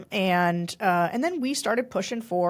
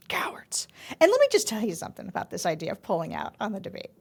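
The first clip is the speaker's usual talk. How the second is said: a quick, fast whisper, softer; whispered aside; 4 semitones lower, 6 dB quieter, faster than usual. Real, not acted. Host mocking the group that backed out, whispering. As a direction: lean in and whisper it like a secret.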